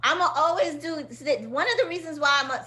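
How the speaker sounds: quick New York Queens accent. Real, not acted. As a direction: let the Queens accent slip out. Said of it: "now my Queens accent is coming out".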